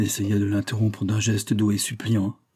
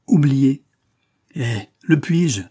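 loud, raised, slowing protest; outraged; 5 semitones higher, 6 dB louder, slower than usual. A narrator's reading. Prosper revolted at being told to forget, outraged. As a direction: protest as though you had heard blasphemy. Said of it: "révolté, comme s'il eût entendu un blasphème".

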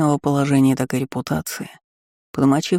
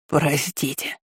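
softer, understated tearful plea; hysterical sobbing; 5 dB quieter, slower than usual. A narrator's reading. Shopkeeper under the raised cleaver, sobbing. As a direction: beg forgiveness weakly, in tears. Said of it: "рыдал истерично лавочник".

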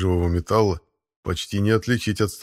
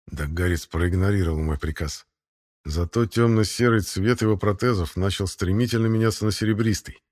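ordinary delivery

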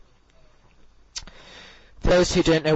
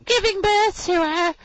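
put-on very high, slow squeaky girl's voice; high, slow mock-reading voice; impression of Beverly; slower than usual. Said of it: "she speaks like this".

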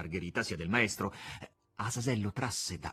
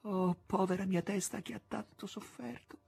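tearful whisper; 8 semitones higher than usual. A narrator's reading. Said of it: "gli sussurrava".

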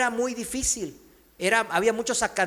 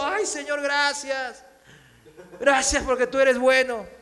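raised-pitch relieved prayer of gratitude; joyful thanks; 4 semitones higher than usual. Retold or imitated. Hanging man thanks the Lord for the branch, joyful relief. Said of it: "Primero se alegró".

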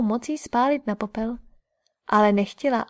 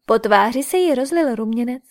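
ordinary delivery

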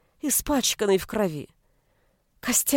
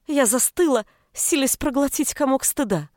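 ordinary delivery, nothing marked

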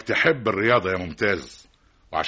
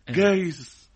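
higher-pitched, indignant one-word question; incredulous echo; 8 semitones higher, much slower than usual. Acted. Father echoing his son's feeble maybe, incredulous. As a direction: throw the word back in disbelief.

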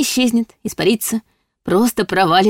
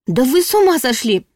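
higher, sharp outraged squeal; shriek; 6 semitones higher than usual. A narrator's reading. Heroine squeals at the demon reaching for her, higher. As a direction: squeal it at him, scandalised. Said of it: "взвизгнула я".

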